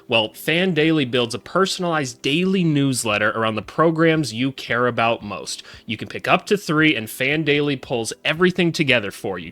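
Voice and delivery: upbeat sponsor pitch; energetic ad read, rising at the end. Acted.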